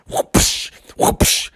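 voiceless whoosh-splat noises; imitated whoosh. Storyteller miming batted eggs, whooshing. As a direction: puff out two quick whooshes.

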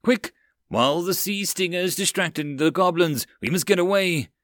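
high, urgent calling out; rally the crew to flee; urgent shout. Acted. Captain urging escape.

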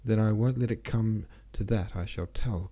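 plain reading voice

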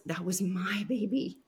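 breathy whispered grief; real whisper. Real, not acted. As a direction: whisper it, barely voiced.

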